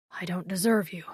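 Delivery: breathy unvoiced murmur. A narrator's reading. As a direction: murmur it into her hair. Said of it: "he murmured against my hair".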